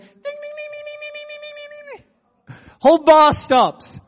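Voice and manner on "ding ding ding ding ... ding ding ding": high, soft spoon-on-glass tinkling; imitated glass ringing. Pastor calling a bar to attention, imitated glass chime. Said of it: "grab the glass and the spoon and went".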